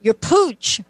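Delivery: put-on coach's Ghanaian accent saying pouch as pooch; light Ghanaian accent, pouch rhymes with smooch. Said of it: "he looked at me with an accent".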